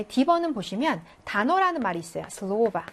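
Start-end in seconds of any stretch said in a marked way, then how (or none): 2.3-2.9 슬로바 with the stress on the 로; 슬로바 carefully sounded out; pronunciation demo. Shown as a demonstration. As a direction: model 슬로바 with a full stressed 오. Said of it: "오의 강세가 있어요".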